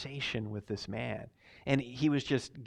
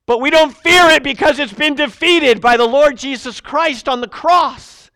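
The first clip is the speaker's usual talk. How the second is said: full-force preaching; 11 semitones higher, 15 dB louder than usual. Acted. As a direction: proclaim victory to the congregation. Preaching to congregation.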